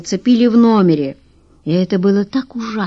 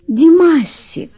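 high, slow coaxing call of his name; businesslike sweetheart; 9 semitones higher, 4 dB louder, slower than usual. A narrator's reading. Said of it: "деловито сказала его волшебница".

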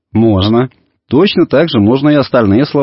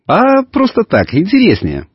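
a hint of a carefree kid, pitched up; lightly voiced boy; 11 semitones higher than usual. A narrator's reading. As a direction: lightly play a boy, stretching the first words. Boy answers father in story.

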